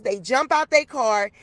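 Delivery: ordinary talk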